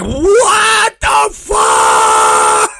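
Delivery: slow, loud, high what the fuck; shocked yell. Retold or imitated. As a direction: drag it out in loud disbelief. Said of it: "It's like".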